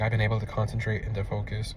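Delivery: soft, even and settled; calm focused voice. Retold or imitated. Grounded client reporting his progress, soft and calm. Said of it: "And he was talking just like that".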